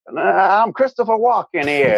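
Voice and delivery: lightly put-on New York accent; Christopher Walken New York accent. Drama student in accent class trying a New York accent. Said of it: "you've gotta do a New York accent".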